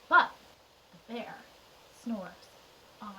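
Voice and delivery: soft, halting storyteller hush; hushed refrain. A narrator's reading. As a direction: let each piece drop softly, with pauses between. Hushed sleeping-bear refrain in story.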